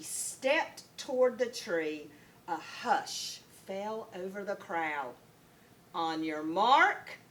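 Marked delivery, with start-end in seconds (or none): 5.9-7.2 lower, slow starter's call; slow, low race-start call; kookaburra starter. A narrator's reading. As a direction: draw it out like a race official. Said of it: "said the kookaburra".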